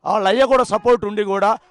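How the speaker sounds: heated accusation. Real, not acted. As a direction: fire it off, outraged at the father. Relative accusing the father.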